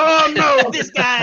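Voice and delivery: loud, high-pitched imitated wail; hammed-up exasperated outburst; mock dismay. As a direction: cry out in mock dread. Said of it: "Every time someone come on".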